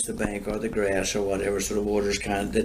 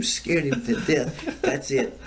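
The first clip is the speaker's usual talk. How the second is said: normal talking voice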